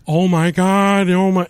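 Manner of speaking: mocking the crowd's praise of the monologue; mock gushing. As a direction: swoon over it like a fan. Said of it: "everyone's like".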